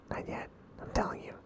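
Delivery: secretive whisper; whispering on the phone. Pretend. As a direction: keep it hushed. Still hiding from Stu.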